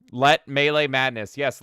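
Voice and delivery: ordinary talk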